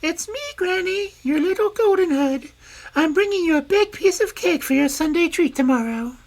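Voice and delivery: put-on wolf putting on a high little girl's voice; wolf pretending to be the girl; wolf faking girl. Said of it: "softening his rough voice as best he can".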